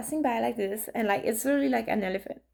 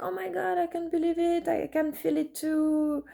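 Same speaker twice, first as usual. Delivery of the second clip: much higher, breathless with emotion; overwhelmed friend; 6 semitones higher than usual. Retold or imitated. Grieving friend writing back, overwhelmed and near tears. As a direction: gush like someone almost crying with joy. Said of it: "she's, like, almost crying".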